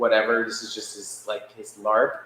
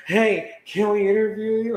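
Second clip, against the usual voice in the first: high, excited, laughing ask; bubbling over with eager excitement; giddy reporter rushing up to a stranger; 10 semitones higher, 4 dB louder than usual. Retold or imitated. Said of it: "and I'm like".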